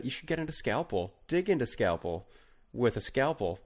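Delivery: ordinary talk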